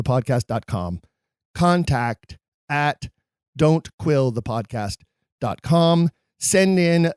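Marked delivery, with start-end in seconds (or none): 1.5-6.1 email address in slow, separated chunks; slow repeat. Real, not acted. Host repeats the email for listeners, slow and deliberate. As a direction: repeat it slowly, pausing between parts.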